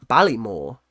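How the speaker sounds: "Ballymore" said the local Baltimore way, the t dropped. Shown as a demonstration. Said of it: "so we say".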